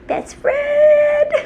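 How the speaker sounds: high laughing cry; laughing shout. Real, not acted.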